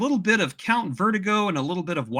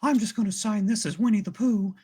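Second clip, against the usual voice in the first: higher, altered cartoon-bear voice; Winnie the Pooh; 7 semitones higher than usual. Voice actor greeting fans at a signing, imitated Winnie the Pooh. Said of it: "he's got a Winnie the Pooh accent".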